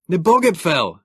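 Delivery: high, loud cry of the name; shouting to the dragged Morlock; alarmed shout. Acted. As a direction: yell it in alarm.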